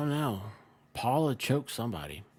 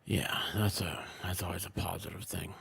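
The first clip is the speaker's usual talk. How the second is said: dry whisper under the breath; hushed deadpan remark; whispered aside; 5 semitones lower, 8 dB quieter than usual. Real, not acted. Dry aside about digits.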